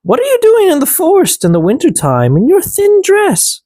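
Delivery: high small elf voice; elves asking. A narrator's reading. Elves asking the girl why she is out, high. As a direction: ask it high and curious. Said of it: "They asked".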